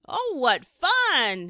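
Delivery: slow, high, smiling cry; delighted. A narrator's reading. Corduroy sliding in soap flakes, delighted. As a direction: relish the slide. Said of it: "he said with a smile".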